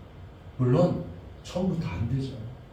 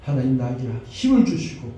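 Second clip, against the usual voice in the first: fervent prayer; 6 dB louder than usual. Acted. Pastor leading the congregation in prayer, fervent. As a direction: pray aloud for the whole church, building.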